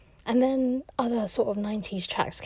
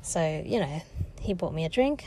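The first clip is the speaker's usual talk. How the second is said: ordinary talk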